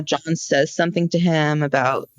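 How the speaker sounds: normal talking voice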